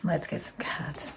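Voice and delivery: whispered aside to herself. Real, not acted. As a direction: breathe it out as a whisper. Repeats the aside, fainter.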